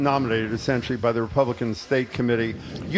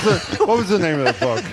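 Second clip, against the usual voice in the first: real laugh through the question; 4 semitones higher than usual. Real, not acted. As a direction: chuckle while asking, a little embarrassed. Host laughs fumbling book title.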